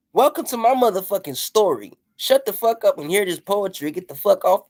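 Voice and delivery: ordinary talk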